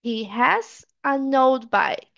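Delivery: very slow, linked English for learners; slow model sentence. Shown as a demonstration. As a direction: say it slowly for students to repeat. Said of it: "Tem que repetir".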